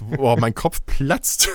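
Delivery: usual speaking voice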